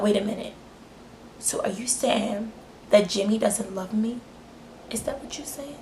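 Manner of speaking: mock the girl's quiet, hurt question; whispering. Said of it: "like, whispering again".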